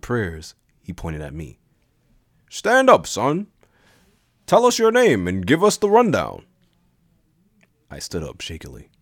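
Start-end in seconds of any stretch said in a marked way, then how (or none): 2.5-6.5 high, loud, commanding teacher; stern teacher. Acted. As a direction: order him up with the voice of a stern teacher. Teacher calls on the student.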